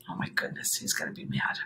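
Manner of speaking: breathy whispered dismay. Real, not acted. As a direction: whisper it in mock shock. Whispered worried aside.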